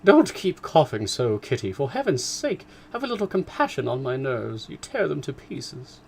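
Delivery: mother scolding Kitty for coughing. Acted. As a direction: nag Kitty about her nerves. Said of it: "began scolding one of her daughters".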